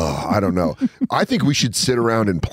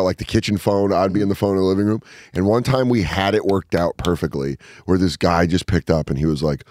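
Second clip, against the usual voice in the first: ordinary delivery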